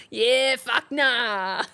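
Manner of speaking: slow words broken up by real laughter; laughing. Real, not acted. Streamer cracking up over Aussie slang, laughing. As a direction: laugh through it, drawn out.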